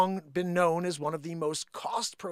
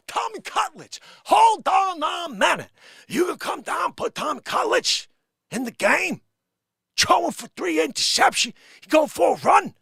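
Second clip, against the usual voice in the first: put-on loud, much higher Bronx accent, slowing on hold on a minute; Bronx accent coach rant; 8 semitones higher, 5 dB louder than usual. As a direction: shout like an exasperated Bronx fan yelling at the coach. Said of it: "speaking of Bronx accents".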